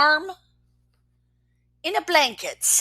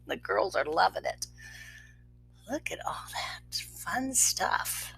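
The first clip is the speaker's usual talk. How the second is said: whispered aside; 4 semitones lower, 15 dB quieter than usual. Real, not acted. Parent pausing the story to whisper to the child. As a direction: murmur the comment under your breath.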